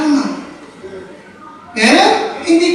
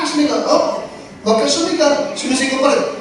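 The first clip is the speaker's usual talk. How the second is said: ordinary talk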